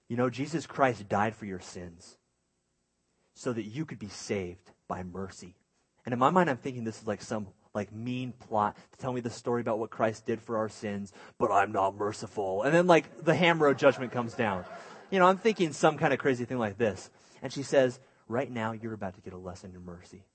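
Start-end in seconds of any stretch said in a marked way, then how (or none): 0.1-5.6 low, measured, softened voice; solemn mom. Retold or imitated. Mom to guilty children in parking lot, quietly solemn. 18.3-20.2 lower, softer, controlled announcement; ominous mom. Retold or imitated. Mom to guilty kids before the lesson, quietly grave.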